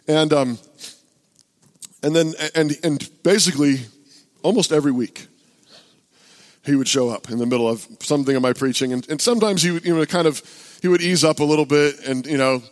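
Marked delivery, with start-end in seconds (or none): none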